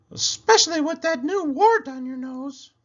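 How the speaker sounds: a llama giving friendly advice; Cherry Sue voice. A narrator's reading. Llama advising her friend the pig, high and sweet. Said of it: "said Cherry Sue".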